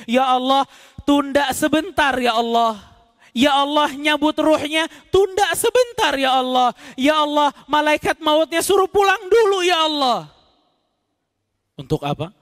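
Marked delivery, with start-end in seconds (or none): 0.0-10.3 higher, urgent pleading for more time; begging for delay. Acted. Dying man begging God to delay the angel of death, urgent plea. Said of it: "mereka mengatakan".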